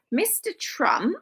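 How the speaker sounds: ordinary delivery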